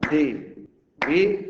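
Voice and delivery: DI-VI-DIR, each syllable a separate loud burst; di-vi-dir broken into three strong voice beats. Shown as a demonstration. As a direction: slowly punch out di, vi, dir. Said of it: "con golpes de voz".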